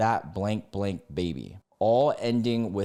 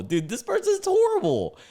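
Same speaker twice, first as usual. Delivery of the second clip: much higher, incredulous cry; appalled outburst; 4 dB louder than usual. Real, not acted. Reacting to the Reddit poster, appalled high cry. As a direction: let the disbelief spill out high.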